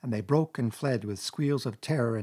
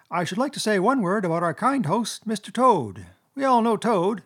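high, louder speechmaker weasel; mock-toast the host in a high squeak; high squeaky voice; 8 semitones higher, 5 dB louder than usual. A narrator's reading. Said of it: "began in a high, squeaky voice".

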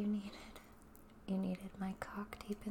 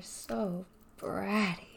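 ordinary delivery

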